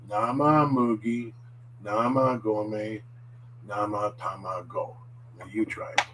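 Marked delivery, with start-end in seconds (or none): 0.1-4.9 slow, clear nama mugi, nama gome, nama tamago; drawn out for learners; real slowly. Shown as a demonstration. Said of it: "say this all together real slowly".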